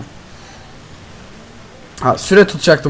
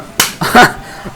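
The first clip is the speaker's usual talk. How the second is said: breathy laugh; 8 semitones higher than usual. Real, not acted. Laughing at teammate.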